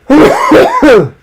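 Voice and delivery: loud choking coughs; coughing fit. Real, not acted. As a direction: cough hard and high. Choking on peanuts.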